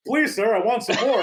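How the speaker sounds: put-on timid orphan boy voice, high; Oliver pleading. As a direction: beg like a small orphan. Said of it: "when Oliver goes up and goes".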